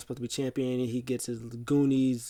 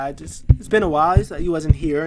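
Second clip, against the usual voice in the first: mock pleading; 3 semitones higher, 9 dB louder than usual. Heyman excusing himself to Brock, loud flustered pleading. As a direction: plead loudly in a rush.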